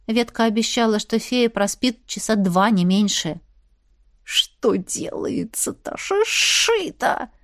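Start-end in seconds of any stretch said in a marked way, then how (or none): 4.2-7.3 slow, ringing snake whisper; a ringing hiss of a whisper. Acted. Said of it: "раздался звенящий шёпот".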